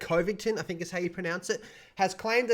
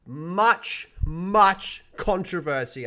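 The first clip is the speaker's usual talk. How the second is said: much, much: each "much" slow and louder, stressed hard; emphatic repetition; 3 semitones higher, 6 dB louder, much slower than usual. Real, not acted. Vlogger stressing Blizzard's scandals to viewers, slow and emphatic. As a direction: lean hard on both "much" words, dragging them out.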